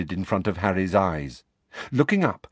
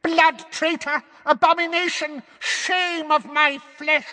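screeching hag. A narrator's reading. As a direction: spit each insult like a curse. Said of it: "she howled".

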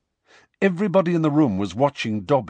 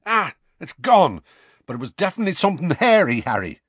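excited; 7 semitones higher, 3 dB louder, faster than usual. Acted. Seamus excited about patronus.

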